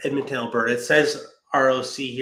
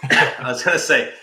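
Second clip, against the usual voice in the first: laughing; 6 dB louder than usual. Real, not acted. Laughing after choking fit.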